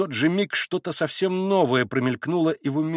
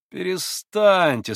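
higher, drawn-out plea; urging to stop; 6 semitones higher, 3 dB louder, much slower than usual. A narrator's reading. Kolganov suddenly urges stopping.

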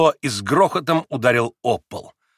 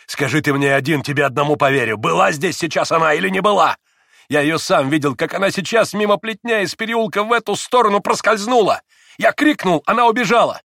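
urgent plea climbing high and loud; agitated, raised outpouring; feverish insistence; 6 semitones higher, 4 dB louder than usual. A narrator's reading. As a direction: insist on what was seen, getting more worked up. Dmitry's feverish questioning.

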